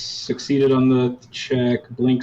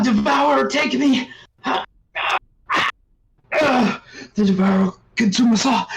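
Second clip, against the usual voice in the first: high, harsh fanatical screams; screaming. Acted. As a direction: scream in terrified frenzy, far above your voice. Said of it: "this, like, this screaming".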